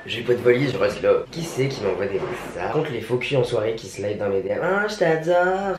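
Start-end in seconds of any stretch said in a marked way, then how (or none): 4.6-5.8 high, drawn-out fake adoration; mock gushing. Vlogger mimicking two-faced party friends in the DMs, mock gushing. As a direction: coo it falsely, pitched way up.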